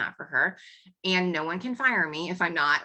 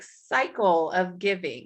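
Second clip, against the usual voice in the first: ordinary delivery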